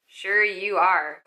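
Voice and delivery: slow, drawn-out disbelief; slow sarcasm. Retold or imitated. Casting director doubting the actress, slow sarcasm. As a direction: stretch it out, unconvinced. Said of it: "they're like".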